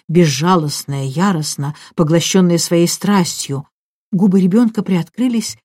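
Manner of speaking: ordinary delivery, nothing marked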